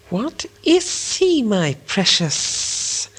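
very high, slow, creeping creature whisper; Gollum whispering. A narrator's reading. Gollum asking himself about the stranger, high slow whisper. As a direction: coo the question to yourself, slow and hissing. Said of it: "whispered Gollum".

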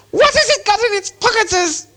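sharp, very high creature screech; hissing Gollum. A narrator's reading. Gollum's suspicion of Bilbo grows, hissing sharply. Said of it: "hissing louder and sharper".

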